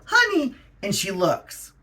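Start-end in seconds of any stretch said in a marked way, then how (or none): 0.1-0.6 calling the dog. Retold or imitated. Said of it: "And then I go".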